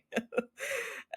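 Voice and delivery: breathless silent laugh; airy laugh. Real, not acted. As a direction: wheeze with laughter. Laughing at the story.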